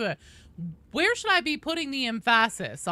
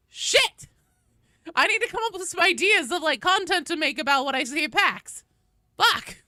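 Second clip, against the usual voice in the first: high, loud mock panic; panicked exclamation; 7 semitones higher, 6 dB louder than usual. Streamer acting out panic over the PAX badge. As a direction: blurt it out in a retold panic. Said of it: "And so now I'm like".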